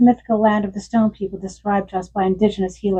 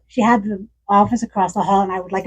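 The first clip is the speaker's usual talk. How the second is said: ordinary talk, nothing marked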